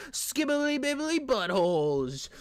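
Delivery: silly voice. Pretend. Silly made-up voice.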